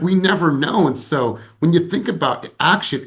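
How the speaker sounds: ordinary talk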